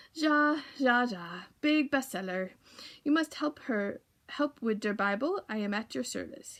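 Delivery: put-on jolly German accent, ja ja; cheerful old printer in a German accent. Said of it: "with a thick German accent".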